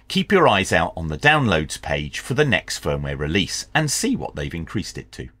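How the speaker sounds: ordinary talk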